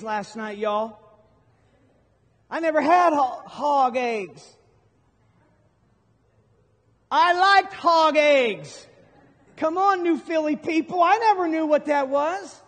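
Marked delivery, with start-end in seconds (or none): 2.5-12.6 much higher and louder, gleeful; excited. Real, not acted. Preacher tells the crowd about hog eggs, loud and excited. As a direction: pitch it up big and loud, marvelling at the food.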